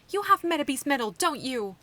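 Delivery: loud accusing cry; shouting. Acted. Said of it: "Erika shouted".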